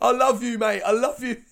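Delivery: loud, high-pitched declaration of love; retold euphoric shout to a mate; gushing outburst. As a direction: blurt it out, overjoyed and loud.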